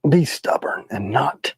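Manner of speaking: whispering. Pretend. Mock defiance, said aside.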